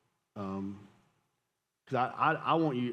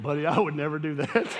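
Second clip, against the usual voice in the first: laughing through the line, louder; can't keep a straight face telling it; 3 semitones higher, 6 dB louder than usual. Real, not acted. Dad laughing as he recalls lying to his son.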